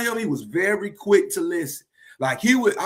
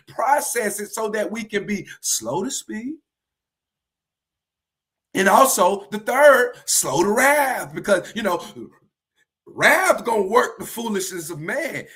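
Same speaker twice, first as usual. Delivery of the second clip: usual speaking voice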